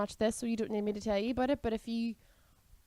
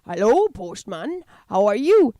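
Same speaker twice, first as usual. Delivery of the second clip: mock Welsh old man, louder; louder imitation of a Welsh accent; imitating the Welsh bit; 7 dB louder, slower than usual. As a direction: parrot his Welsh postman greeting. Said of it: "and then you were like".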